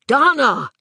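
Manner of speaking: sharp urgent shout. A narrator's reading. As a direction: shout her name to stop her. Vic shouts at her.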